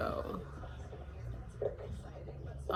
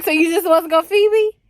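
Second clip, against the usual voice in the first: loud, higher hungry whine; mock complaint; 10 semitones higher, 18 dB louder than usual. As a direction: loudly demand why nobody fed you. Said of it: "interrupt and be like".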